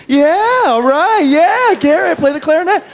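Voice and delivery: high, loud mock crowd-rousing; imitated hype man whooping up fans; rallying cheer. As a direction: cheer them on at the top of your range. Imitating colleague egging crowd on.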